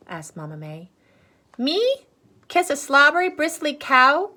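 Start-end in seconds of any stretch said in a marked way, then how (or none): 1.6-4.3 high loud disgusted disbelief; appalled child. Acted. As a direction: recoil at the very idea. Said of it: "cried Annalisa".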